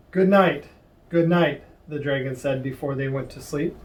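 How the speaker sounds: usual speaking voice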